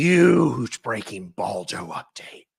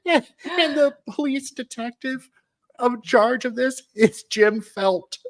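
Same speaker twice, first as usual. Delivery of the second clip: giggling; 12 semitones higher than usual. Real, not acted. Giggly high read.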